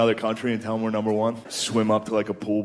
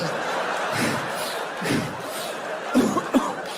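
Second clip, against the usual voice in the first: imitated heavy panting, no voice; pug-like breathing; 9 semitones higher, 4 dB quieter than usual. Tired lover hunched over her ear, mock pug breathing. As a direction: huff and cough like a winded pug. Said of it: "breathing in her ear like a pug".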